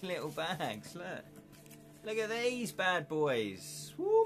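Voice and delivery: ordinary talk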